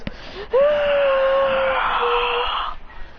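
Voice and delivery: long, high strained yell; high sustained power-up cry; acted power scream. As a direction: scream while growing gigantic. Hero screams while growing.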